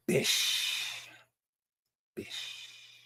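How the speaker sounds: sudden drop into a breathy whisper; whispered bish. Pretend. Finishing the chat bit with a breathy whispered bish. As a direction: hiss it after the shout.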